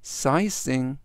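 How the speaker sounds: measured word-by-word reading for repetition; slow model reading. Shown as a demonstration. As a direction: give each repeat room for learners to follow. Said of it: "천천히 세 번씩 읽어드리고요". (따라 하도록 천천히 읽기)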